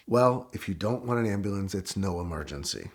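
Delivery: very low, hushed deadpan officer; stoic officer. Retold or imitated. Officer answering the driver at the car, stoic. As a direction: drop low and quiet, playing the unmoved cop. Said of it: "he's just stoic and he says".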